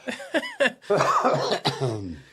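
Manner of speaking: high, loud burst of laughter; loud belly laugh. Real, not acted. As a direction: crack up helplessly, loudly. Laughing at Escobear pun.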